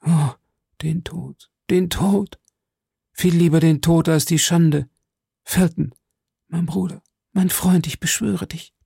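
high, veiled pleading voice; veiled cry. A narrator's reading. Lady begging the officer for death, veiled cry. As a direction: implore him in a high, muffled cry. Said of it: "verschleierte die Augen und die Stimme".

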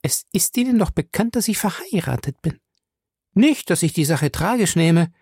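high-pitched, slightly mannered woman; Fay's character voice, affected. Acted. Fay reminds Featherstone she is married, high and slightly affected. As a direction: play a lady putting on small airs.

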